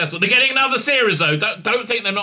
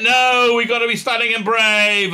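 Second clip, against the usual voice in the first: high, loud mock defiance; hammed-up heroic insistence; mock heroic protest; 7 semitones higher, 5 dB louder than usual. Mocking hero answering a doomed-plan warning, loud heroic protest.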